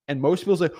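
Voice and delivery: usual speaking voice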